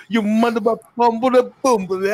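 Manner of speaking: high-pitched mock Jamaican patois cursing; mock-furious patois rant; imitated patois insults. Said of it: "form of patois where he was insulting them".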